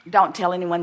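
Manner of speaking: ordinary talk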